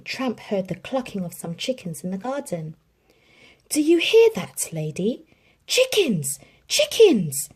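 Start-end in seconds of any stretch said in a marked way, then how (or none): 3.7-7.5 high, loud, eager dog voice; excited cry. A narrator's reading. Tramp eagerly tells Lady about chickens, excited. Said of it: "cried Tramp".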